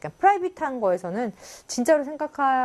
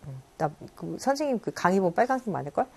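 ordinary talk, nothing marked

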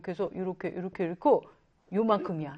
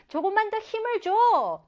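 high, urgent encouragement; acted supporter's cheer, pitched high; 9 semitones higher than usual. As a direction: egg someone on to push harder. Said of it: "동물들의 응원에 힘입어".

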